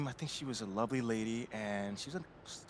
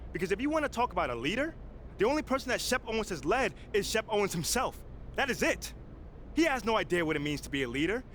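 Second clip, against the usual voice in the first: firm public address, building to passionate peaks; press conference speech; 3 semitones higher than usual. Acted. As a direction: make your case to the reporters, rising as you push. Player speaks at press conference.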